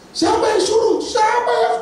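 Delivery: very high, loud jeering shout; mocking shout. The crowd jeering at Moses, very high and loud. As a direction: scream the taunt. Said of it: "ngomong terus".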